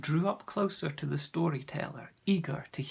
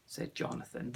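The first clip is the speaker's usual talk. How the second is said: usual speaking voice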